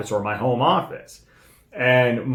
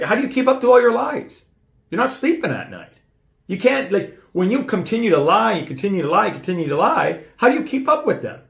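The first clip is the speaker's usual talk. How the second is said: high-pitched, imitating a baffled boss; retold incredulous challenge; 8 semitones higher than usual. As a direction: question him in disbelief, pitch jumping up. Voicing boss's incredulous challenge about lying.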